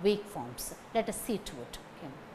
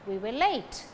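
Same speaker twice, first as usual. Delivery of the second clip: "late" stressed, "We were" kept weak. Shown as a demonstration. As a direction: lean only on "late". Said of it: "Late carry stress".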